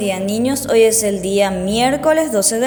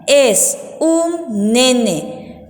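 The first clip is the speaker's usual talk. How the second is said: very slow, word by word; dictation repeat; much slower than usual. Shown as a demonstration. Teacher repeats sentence three for writing, very slow and separated. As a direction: repeat with each word stretched apart.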